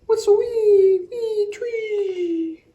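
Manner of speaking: high, loud chirpy birdsong; sung bird call; starling song. Acted. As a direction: trill it like a starling. Reader voices starling song.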